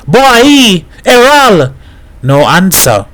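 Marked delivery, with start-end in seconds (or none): 0.1-1.7 high, carrying call; shouting. A narrator's reading. Hat calls the boys in the street, shouting. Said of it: "Hat shouting in the street".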